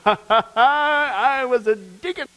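high, loud, slow, laughing brag; a laughing self-important boast; mock boasting. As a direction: drag it out like a proud churchgoer. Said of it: "So where's the boasting?".

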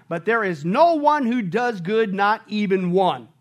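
slow, weighted, stress on no and not; emphatic slow quote. Real, not acted. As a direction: drive home every word. Quoting Romans emphatically.

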